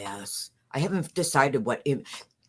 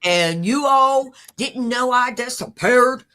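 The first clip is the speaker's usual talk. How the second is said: higher, louder mock Southern voice; imitating Soup; 7 semitones higher, 7 dB louder than usual. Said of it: "I gotta get right voice for that".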